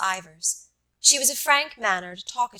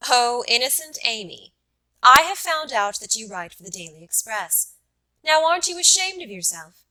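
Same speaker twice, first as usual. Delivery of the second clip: louder, pitch rising to a high, teasing rebuke; blunt teasing; 3 semitones higher, 6 dB louder than usual. Acted. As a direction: tease him bluntly with mock scorn. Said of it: "she said bluntly".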